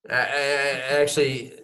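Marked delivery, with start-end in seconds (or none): 0.1-0.1 airy laugh bursting through; a held-back laugh escapes; breathy stifled laugh. Real, not acted. Laugh breaks the straight face.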